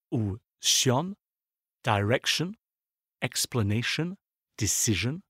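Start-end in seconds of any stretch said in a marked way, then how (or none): 1.8-5.2 "direction, explanation, decision", each stressed just before the ending; penultimate-syllable stress. Shown as a demonstration. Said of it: "sont accentués sur l'avant-dernière syllabe".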